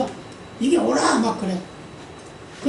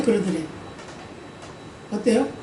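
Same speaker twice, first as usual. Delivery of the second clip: ordinary talk, nothing marked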